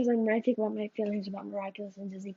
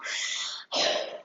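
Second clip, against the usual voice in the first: long unvoiced fed-up sigh; exasperated sigh; 5 dB quieter than usual. Real, not acted. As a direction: let out a tired breathy sigh. Fed up with the topic.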